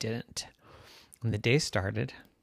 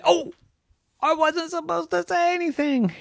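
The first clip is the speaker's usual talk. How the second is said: put-on much higher girl's voice, caught out; high sheepish girl's voice; startled little girl. A narrator's reading. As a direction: say it like a child who just broke her promise. Yvette catches herself.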